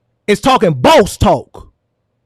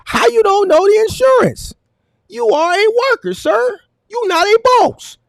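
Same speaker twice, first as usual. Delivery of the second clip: pitched up high, deliberately spaced words; incredulous put-down; 8 semitones higher than usual. Real, not acted. Host dressing down the caller as a mere worker, high and incredulous.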